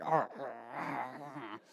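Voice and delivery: slow, low growling arr; mock teeth gnashing. Furious council members gnashing their teeth, mock growls. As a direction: imitate angry men gnashing their teeth, growled softly. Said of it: "I assume it's like".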